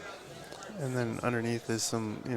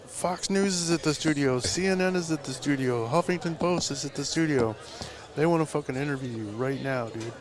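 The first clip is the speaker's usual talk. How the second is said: higher-pitched, urgent retold announcement; excited news-breaking; 4 semitones higher than usual. Assistant waking the tattooist with press news, excited. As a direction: pile up the big news, one outlet after another. Acting out the breathless news report.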